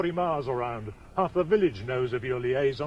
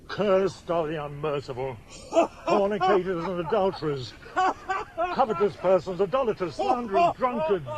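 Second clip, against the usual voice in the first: high, raised-voice litany of sinners; denouncing curse prayer; 6 semitones higher, 3 dB louder than usual. Acted. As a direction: build from a firm curse to high, ringing condemnation. Priest prays a curse at brother.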